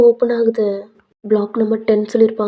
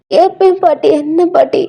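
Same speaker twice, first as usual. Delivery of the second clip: higher, louder earnest reply; eager grandson; 7 semitones higher, 6 dB louder than usual. Acted. Grandson agreeing to listen to grandmother, earnest high voice.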